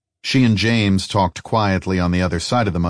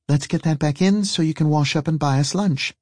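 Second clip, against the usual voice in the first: higher character voice, giving instructions; brisk woman companion; 8 semitones higher than usual. Acted. Woman telling her companion to finish the job, higher-voiced and in charge.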